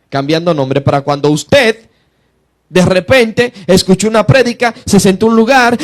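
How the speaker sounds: normal talking voice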